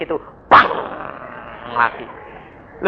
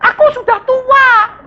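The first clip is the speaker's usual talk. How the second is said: put-on high, slow creaky old-timer voice; mock old person; 4 dB louder, slower than usual. Preacher mocking an old believer's excuse, slow and high.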